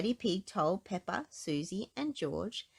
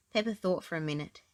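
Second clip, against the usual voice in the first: plain reading voice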